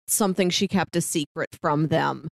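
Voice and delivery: ordinary delivery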